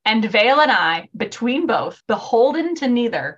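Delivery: solemn quote reading. Acted. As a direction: read it like verse so it sinks in. Reads the quote again to sink in.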